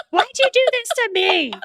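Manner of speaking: high-pitched hammed-up complaint; mock accusing wail. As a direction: wail it at your husband, joking. Acted accusation at husband amid laughter.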